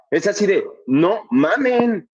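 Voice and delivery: ordinary delivery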